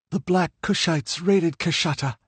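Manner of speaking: put-on high, earnest girl's voice; frightened young woman. A narrator's reading. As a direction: captive introducing herself to a stranger. Livia's voice in narration.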